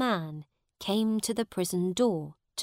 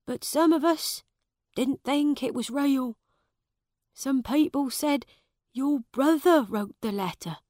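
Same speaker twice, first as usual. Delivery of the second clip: put-on raised, anxious old servant voice; worried old servant; 5 semitones higher than usual. A narrator's reading. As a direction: tell it like a worried old servant sharing bad news.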